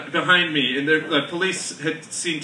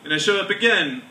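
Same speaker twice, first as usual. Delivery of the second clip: normal talking voice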